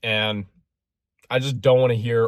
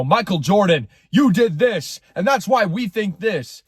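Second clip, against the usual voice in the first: pompous high horse; 7 semitones higher, 3 dB louder than usual. Pretend. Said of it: "if I went up on my high horse".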